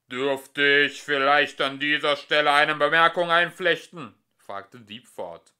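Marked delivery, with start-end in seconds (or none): 0.1-4.1 huge, loud booming machine voice. A narrator's reading. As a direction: boom through the room. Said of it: "dröhnte eine mächtige Stimme".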